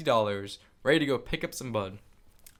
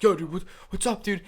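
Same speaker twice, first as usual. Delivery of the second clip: higher-pitched, mock-confrontational challenge; mad confrontation; 8 semitones higher than usual. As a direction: imitate an irritated guy squaring up. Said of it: "him and his friend get really mad".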